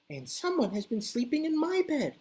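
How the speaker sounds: a middling Mama Bear voice; medium Mama Bear. Acted. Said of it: "in her medium-sized Mama Bear voice".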